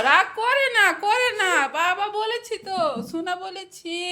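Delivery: high, sing-song soothing. Real, not acted. Mother to toddler sulking after scolding, coaxing.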